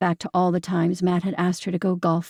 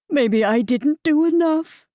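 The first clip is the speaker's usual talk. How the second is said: slow, higher, choking on tears; choked out; 8 semitones higher, 5 dB louder, slower than usual. A narrator's reading. Wife blames herself to her sister, choking slowly on tears. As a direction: force it past a tight throat. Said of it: "Carol choked out".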